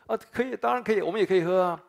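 high, loud and eager, imitating the disciples; eager agreement. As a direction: chime in like keen disciples saying yes. (模仿门徒，又高又响地急切答应)

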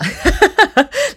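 high, loud giggle; bright giggling burst; laugh. Real, not acted. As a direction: giggle at your own story. Laughs about following her intuition.

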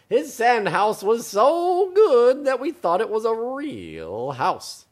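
high, slow gushing, dropping at the end; yelled praise. Acted. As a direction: marvel loudly as the judges. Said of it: "they yelled".

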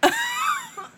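sudden high-pitched laugh. Real, not acted. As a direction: squeal of laughter. Laughs at friend's scenario. (尖声大笑)

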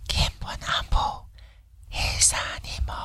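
breathy ASMR whisper, close and soft. Shown as a demonstration. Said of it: "用A S M R的方式说出".

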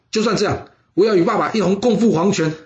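lightly acted defiant resolve; resolute insistence. A narrator's reading. Son insists on dying with father.

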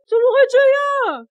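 very high wail of disbelief; shocked dismay. Acted. Assistant seeing a bear in the mirror, high shocked wail. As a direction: cry out in dismay at the discovery.

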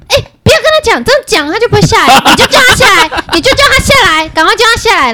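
high, pressing mock urgency; hurried high-pitched retelling of a panicked call; urgent retold plea. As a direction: imitate a frightened friend begging. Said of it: "紧接听到另一个人说".

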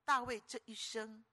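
ordinary talk

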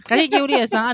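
usual speaking voice

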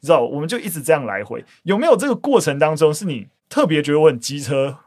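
ordinary talk, nothing marked

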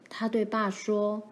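usual speaking voice

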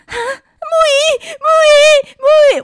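high, loud mock wail of a name; gasp, then shriek the name; imitating panicked mother. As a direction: cry out for a daughter in hammed-up alarm. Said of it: "怎么那么慌张".

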